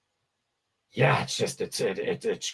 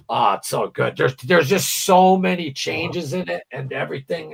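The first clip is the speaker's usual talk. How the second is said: ordinary delivery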